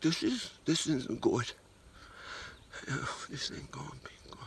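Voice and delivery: hushed, dread-filled whisper to himself; worried whisper. Retold or imitated. Man in the grocery store feeling his gut gurgle, low worried whisper. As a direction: whisper it like trouble is coming. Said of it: "And I was like".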